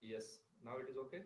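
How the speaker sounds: faint, distant microphone; barely audible. Real, not acted. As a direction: voice fading far away. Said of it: "Still not audible".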